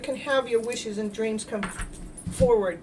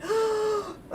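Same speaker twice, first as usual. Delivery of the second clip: gasp; 11 semitones higher than usual. Retold or imitated. Reliving a sudden jolt.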